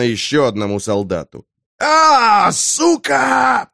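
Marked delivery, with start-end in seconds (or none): 1.8-3.7 high pained cry of a shot soldier; wounded soldier groaning. A narrator's reading. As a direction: yelp in pain, then curse. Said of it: "простонал боец".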